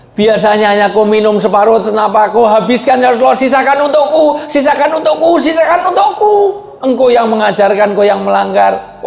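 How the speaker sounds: high, rising pleading wife's voice; Aisyah pleading. Acted.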